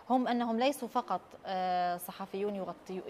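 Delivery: ordinary delivery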